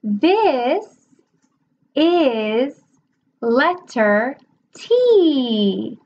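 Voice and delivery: very slow, stretched sentence; slow teacher model. Shown as a demonstration. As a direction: say each word with deliberate slowness. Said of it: "show them like this".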